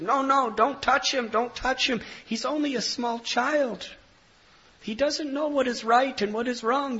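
put-on high-pitched plea on a child's behalf; urgent protest. Brahmin urgently defends the child.

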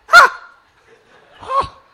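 sudden loud high yelps of pain. Retold or imitated. Devotee stung by jellyfish, loud high yelps. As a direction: shriek as if stung.

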